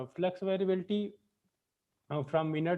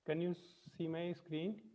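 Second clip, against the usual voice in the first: barely audible, far from the mic; weak voice, distant from the microphone; faint voice; 10 dB quieter than usual. Real, not acted. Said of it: "very faint voice".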